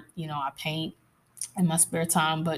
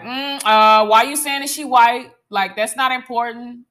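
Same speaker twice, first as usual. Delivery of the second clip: skeptical listener; 6 semitones higher, 11 dB louder than usual. Retold or imitated. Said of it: "I probably got some people like".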